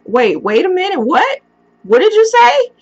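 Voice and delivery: can't believe what she just heard; shocked disbelief. Retold or imitated. A girlfriend on the phone hears her boyfriend is dead, stunned high-pitched disbelief. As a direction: voice leaping high and loud in shock.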